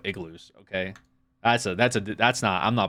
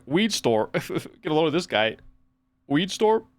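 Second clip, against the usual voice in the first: mocking imitation; 5 semitones higher than usual. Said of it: "Guy's like".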